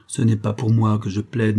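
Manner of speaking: usual speaking voice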